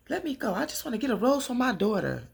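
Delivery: high-pitched acted protest; cutting up. [name] tells Leah to let her go, high frantic protest. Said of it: "[name] is cutting up".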